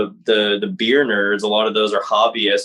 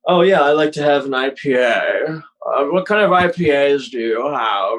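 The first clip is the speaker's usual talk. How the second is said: hammed-up, higher-pitched beer snob; pretentious; 4 semitones higher than usual. Mocking craft beer fans to podcast friends, pretentious. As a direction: put on an affected, pretentious connoisseur voice. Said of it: "make them sound pretentious enough to say like".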